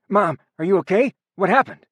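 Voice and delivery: put-on a high, loud, frightened boy; alarmed. A narrator's reading. Son running to his mother after the attack, alarmed. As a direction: rush to her in panic.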